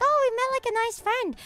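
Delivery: high, chirpy quoted friend; mimicking a sweet friend's high chirp. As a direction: lift the voice way up for her line.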